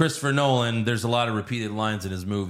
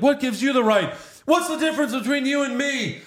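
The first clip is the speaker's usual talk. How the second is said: much higher and louder whiny challenge; squeaky indignant fake Batman; 4 dB louder than usual. The fake Batman challenging Batman, squeaky high and loud. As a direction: demand it in a squeaky outraged voice.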